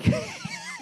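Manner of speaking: high, soft wheezing laugh; wheezy laugh. Real, not acted. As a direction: crack up at your own impression. Cracks up at own impression.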